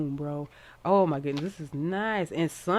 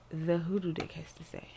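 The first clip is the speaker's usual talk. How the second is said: soft breathy whisper over the deck; 5 dB quieter than usual. Real, not acted. Reader whispering as she shuffles the Hoodoo deck, hushed. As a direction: hush it as you draw. Whispering while pulling cards.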